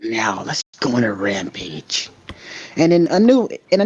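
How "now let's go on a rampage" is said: low, slow villain voice; Carnage. Acted. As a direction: drag it out, low and menacing. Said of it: "this is Carnage, he's like".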